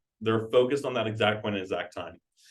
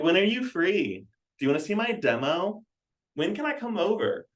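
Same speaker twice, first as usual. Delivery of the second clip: higher, mildly mocking questions; mimicked sales rep; 7 semitones higher than usual. Sales trainer mimicking reps' cringeworthy questions, higher mock voice. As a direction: imitate an over-eager caller with lifted pitch.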